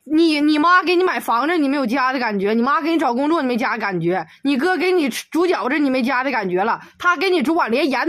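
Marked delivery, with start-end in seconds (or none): none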